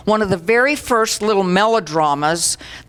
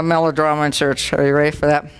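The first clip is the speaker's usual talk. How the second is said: normal talking voice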